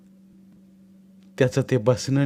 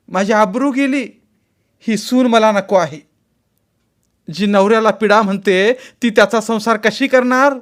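high, loud lamenting rant; bitter ranting; 9 semitones higher, 4 dB louder than usual. A narrator's reading. Mother-in-law ranting about the bride, high and bitter. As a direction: rage and wail over her ruined house. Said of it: "सासू कितीतरी वेळ तशीच बडबडत होती".

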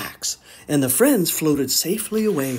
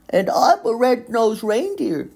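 put-on young Rudolph; 7 semitones higher than usual. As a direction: answer like a shy young reindeer. Rudolph replies.

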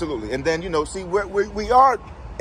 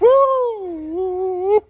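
high, loud celebratory woo; excited whoops; 12 semitones higher, 3 dB louder, much slower than usual. Real, not acted. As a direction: whoop it up for the group. Hyping up the tour guests.

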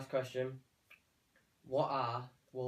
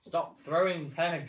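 annoyed shout; 6 semitones higher, 9 dB louder, slower than usual. Real, not acted. Annoyed at pegs being thrown.

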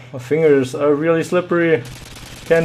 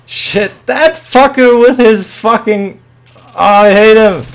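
high, loud, exasperated rant; angry outburst; 9 semitones higher, 9 dB louder than usual. Real, not acted. Gamer killed by the same guy, angry outburst. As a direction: yell your hatred of that player.

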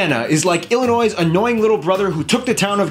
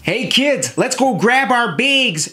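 put-on higher-pitched, drawn-out Minnesota accent; 5 semitones higher, slower than usual. Minnesotan calling the kids to go read, Minnesota accent. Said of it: "they have kind of funny accents".

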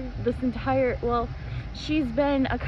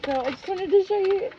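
excited show and tell; 7 semitones higher, 3 dB louder than usual. Real, not acted. Showing off her hair pin.

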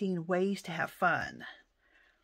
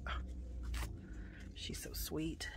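whisper; 3 semitones lower, 8 dB quieter than usual. Real, not acted. Touched by gift.

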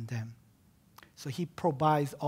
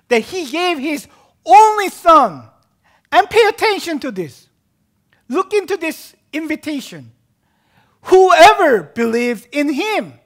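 high, loud pulpit proclamation; full-force preaching; 12 semitones higher, 16 dB louder than usual. Real, not acted. Preacher urging listeners to heed the invitation, full-force preaching. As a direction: drive each phrase out as a loud invitation.

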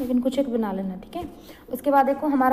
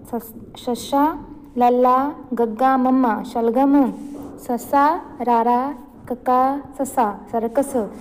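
slow spelling drill; slower than usual. Shown as a demonstration. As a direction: spell each word out slowly for the class. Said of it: "जैसे आपको दिखाई दे रहा है".

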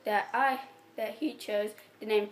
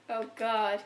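usual speaking voice

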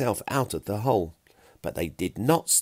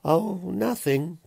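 floaty dreaminess; 10 semitones higher, slower than usual. A narrator's reading. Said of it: "feeling strangely floaty".

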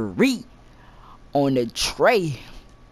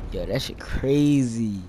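normal talking voice

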